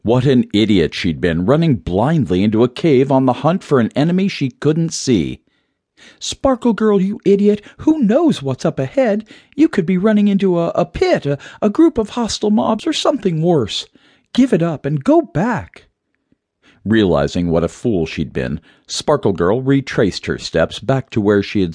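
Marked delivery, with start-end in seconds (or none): 6.2-15.9 high-pitched heroine voice; high, agitated self-talk; scolding herself. A narrator's reading. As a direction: berate yourself anxiously, pitched way up.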